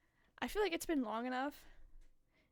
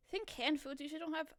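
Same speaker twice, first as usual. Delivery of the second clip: ordinary delivery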